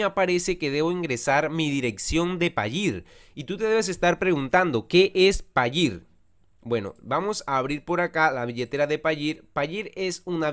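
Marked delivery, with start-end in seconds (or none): none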